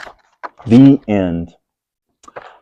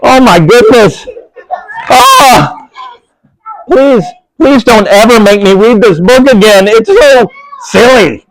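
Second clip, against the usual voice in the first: mock pleading; 7 semitones higher than usual. Reader to kids, hammed-up mock begging. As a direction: beg like the book wore you out. Reader begs not to read again.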